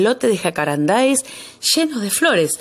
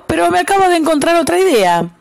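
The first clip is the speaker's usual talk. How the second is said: higher, bright-eyed little louse with a new idea; eager louse; 10 semitones higher, 3 dB louder than usual. A narrator's reading. The louse telling the animals he has an idea, higher and eager. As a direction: pipe up high with the idea. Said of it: "dijo el piojo".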